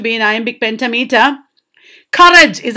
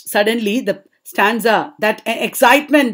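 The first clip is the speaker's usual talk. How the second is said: ordinary talk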